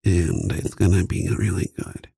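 slow hushed mukbang ASMR; leaning in to whisper. Pretend. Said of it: "an A S M R duo".

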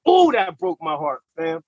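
normal talking voice

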